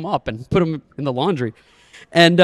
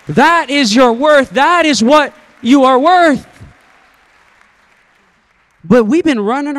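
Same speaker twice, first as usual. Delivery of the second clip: preaching; 8 semitones higher, 7 dB louder than usual. Real, not acted. Preaching climax.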